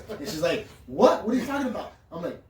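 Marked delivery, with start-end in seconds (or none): 0.9-1.9 surprised. Retold or imitated. Voicing Betsy's confused reply.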